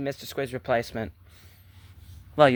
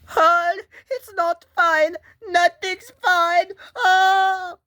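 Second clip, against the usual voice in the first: very high, loud, slow crying shriek; panicked wail; 5 dB louder, much slower than usual. Acted. Rejecting a pal's reassurance, wailing in panic. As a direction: draw every word out in a piercing wail.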